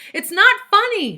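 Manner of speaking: high, very loud shout; shouting as loud as possible. A narrator's reading. Allison in the stairwell, desperate full shout. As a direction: yell it with everything she has. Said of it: "she shouted as loud".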